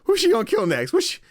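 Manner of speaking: high, loud, stumbling worried questions; mock anxious suspense. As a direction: play a player frantic about what comes next. Player's retold anxious guessing.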